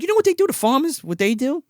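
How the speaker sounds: fast high mock mom. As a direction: press him like an eager mom. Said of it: "He's like".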